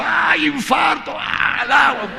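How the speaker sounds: high, slow mock fainting cries; hammed-up panic. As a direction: play the lady collapsing in a show of panic. Woman throws herself to floor.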